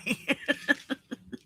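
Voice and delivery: breathy laugh through tears; a little wet laugh; soft tearful laugh. Real, not acted. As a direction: laugh it off while welling up.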